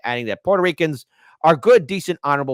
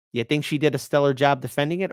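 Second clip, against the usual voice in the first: normal talking voice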